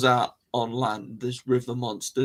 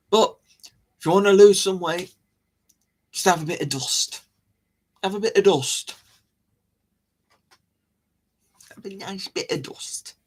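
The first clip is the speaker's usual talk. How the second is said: lightly put-on, higher comedy character voice; Little Britain impression; 6 semitones higher than usual. Quoting a dieting joke from Little Britain, mock character voice. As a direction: trot out a sketch-show catchphrase in a raised voice. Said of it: "Those of you who've watched Little Britain".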